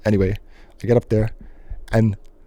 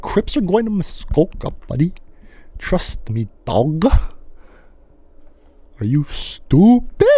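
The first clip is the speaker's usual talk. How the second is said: mock tough talk; 6 semitones higher, slower than usual. Kids boasting as gangsters.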